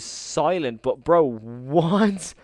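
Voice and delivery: excited. Real, not acted. Surprise donation flood on stream.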